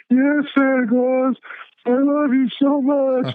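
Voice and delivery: put-on very high, slow child's voice; little kid voice. Retelling the crying boy's words to Santa, high slow child voice. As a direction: squeak it out like a small boy. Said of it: "And he said".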